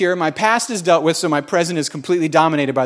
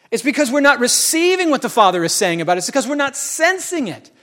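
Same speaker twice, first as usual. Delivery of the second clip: higher, fervent preacher building the crowd; preaching at full force; 7 semitones higher than usual. Acted. Pastor exhorting the congregation about heaven, in a high, fervent preaching voice. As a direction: preach it out, celebrating heaven's party.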